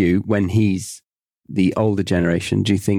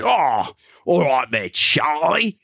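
put-on high, slurry drunk bloke; comically drunk, drawn-out greeting; plastered flatmate; 6 semitones higher than usual. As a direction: imitate a drunk rolling in late. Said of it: "he would get completely plastered and he would come in".

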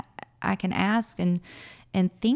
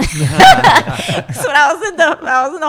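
talking through a big laugh, higher and louder; 10 semitones higher, 14 dB louder than usual. Real, not acted. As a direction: let the laugh spill into the words. Laughs while starting story.

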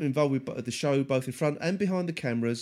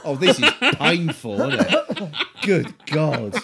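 laughing; 2 semitones higher, 9 dB louder, slower than usual. Real, not acted. Laughing at painful banter.

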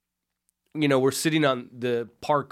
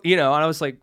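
ordinary talk

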